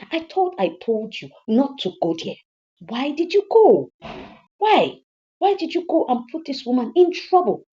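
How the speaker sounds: high, loud, drawn-out reproach; upset mother demanding an answer; exasperated scolding. Acted. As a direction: scold your son in dismay. Mother scolding son for going.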